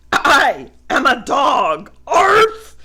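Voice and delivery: loud yelp of a bark; barking dog voice. Acted. The dog speaks.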